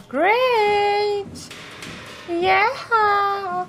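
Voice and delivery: high delighted squeal of triumph; excited cheer. Real, not acted. Celebrating success in the game.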